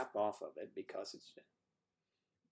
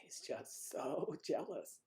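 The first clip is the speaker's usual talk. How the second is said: laughing; 3 semitones higher, 5 dB quieter, slower than usual. Real, not acted. Teasing, laughs mid-word.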